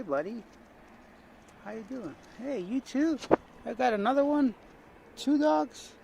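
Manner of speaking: high, sing-song voice for the dogs; talking to dogs. Real, not acted. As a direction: coo at the dogs like they're puppies. Greeting stray dogs.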